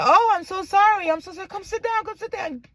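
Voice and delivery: high, hurried apologetic nurse; flustered apology. Retold or imitated.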